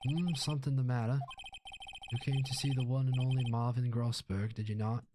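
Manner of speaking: put-on Southern gentleman lawyer; self-important Southern boss voice; pompous lawyer. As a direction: grandly demand his business.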